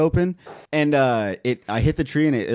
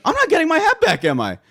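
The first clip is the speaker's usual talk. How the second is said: exasperated; 5 dB louder than usual. Retold or imitated. Retold dismay at losing hat.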